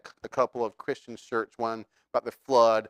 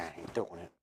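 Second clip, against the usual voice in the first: much softer, lower murmur of "I ain't doing it"; inner thought; 7 semitones lower, 12 dB quieter, faster than usual. Retold or imitated. Peter thinking to himself quietly, much softer and lower. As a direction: say it to yourself under your breath. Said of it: "He's probably thinking".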